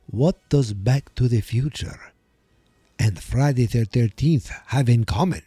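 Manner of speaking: host welcoming listeners as a campy Transylvanian count; vampire accent. Pretend. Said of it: "with a very stereotypical European accent used for vampires".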